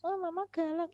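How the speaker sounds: put-on high childish voice; child accusing. Child says to mother she's mean, high pouting voice. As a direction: pout like a kid blaming mom.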